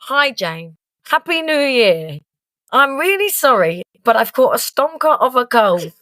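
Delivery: high, slow fake-poorly voice; a friend rehearsing his sick message aloud, mock poorly; fake sick rehearsal. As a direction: rehearse sounding ill. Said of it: "So he's like".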